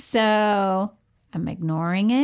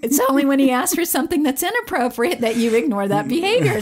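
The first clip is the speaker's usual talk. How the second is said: ordinary talk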